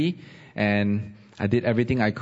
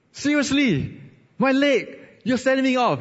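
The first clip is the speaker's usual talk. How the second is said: incredulous protest. Retold or imitated. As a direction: play the stunned recruit who can't believe it. Incredulous protest at the medical officer.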